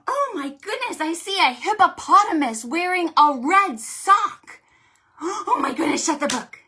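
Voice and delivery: high, loud hammed-up shock; playful alarm at a hippo in a red sock; mock surprise. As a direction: act astonished for the kids.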